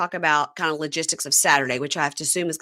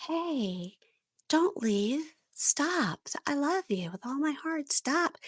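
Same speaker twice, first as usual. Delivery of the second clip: high, soft, put-on sweet pleading; fiancée following him to the bathroom, mock-sweet and soft; 8 semitones higher, 7 dB quieter, much slower than usual.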